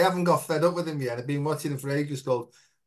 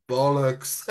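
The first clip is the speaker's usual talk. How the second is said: a lightly booming inner voice, lower; big spiritual voice; 4 semitones lower than usual. Retold or imitated. His inner spiritual voice answering critics, mock solemn boom. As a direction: pronounce it like a solemn oracle. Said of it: "like, a big spiritual voice".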